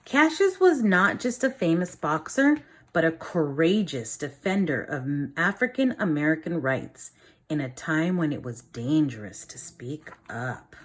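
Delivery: lively storybook reading for a class; reading to children. A narrator's reading. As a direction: read it like a teacher reading a picture book to the class. Reads picture book aloud.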